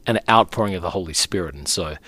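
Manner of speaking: ordinary talk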